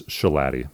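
"shalati" said shuh-LAT-ee; correct surname pronunciation. Shown as a demonstration. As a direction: say "shalati" to rhyme with daddy. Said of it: "word I most hear mispronounced".

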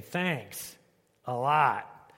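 flat, eye-rolling thanks; sarcastic. Shown as a demonstration. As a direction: drip sarcasm on it.

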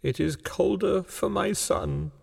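put-on high, soft tearful old woman; mournful, tearful old wife; weeping. A narrator's reading. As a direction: grieve softly in a high voice. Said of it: "and wept afresh".

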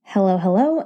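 ordinary talk, nothing marked

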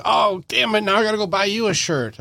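high, grudging mock complaint; mock grumble. Friend given a shirt, mock obliged grumble. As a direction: play the friend who feels he owes a gift.

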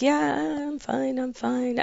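high, soft, drifting "I'm fine"; faint, far-away reassurance, retold; imitating spaced-out. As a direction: imitate someone drugged and off in another universe. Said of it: "You sounded like you were in another universe altogether".

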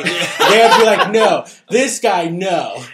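laughing mock. Mock rejection.

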